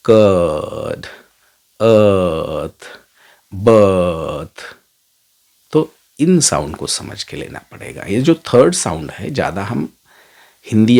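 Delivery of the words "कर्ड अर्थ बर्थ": कर्ड, अर्थ, बर्थ each stretched slowly on a long central ur vowel; drawn-out ur sound. Shown as a demonstration. Teacher drilling students, कर्ड अर्थ बर्थ stretched very slowly. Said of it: "अ sound है जैसे कि बर्ड".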